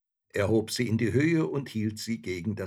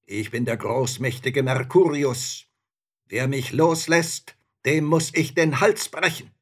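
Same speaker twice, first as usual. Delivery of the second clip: loud, raised, threatening proclamation; raging spirit; 7 semitones higher, 9 dB louder than usual. A narrator's reading. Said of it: "rief der Geist".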